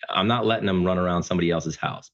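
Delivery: usual speaking voice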